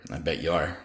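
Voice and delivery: quiet, near breaking; softly, barely composed; holding back tears. Acted. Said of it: "fighting to hold on to his composure".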